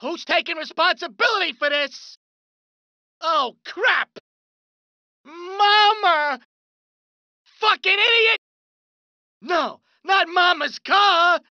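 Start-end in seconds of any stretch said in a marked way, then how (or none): 3.2-11.4 outraged yell. Acted. Thug seeing his car wrecked.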